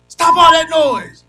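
grown-up shouting. Retold or imitated. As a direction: bark it now as the tired adult. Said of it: "Now that I'm older".

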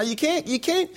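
ordinary talk

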